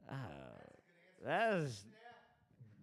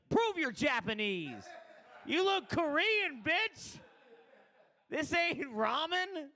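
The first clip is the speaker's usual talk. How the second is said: much higher, slow mock rant; mock demanding customer; 10 semitones higher than usual. Customer berating the sushi lady, hammed-up high rant. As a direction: squeal it like an outraged customer.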